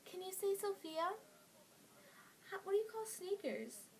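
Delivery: high, loud mock-starstruck squeal of questions; gasp, then gush loudly in a high voice, imitating amazed girls; excited gushing girls. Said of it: "this was their expression".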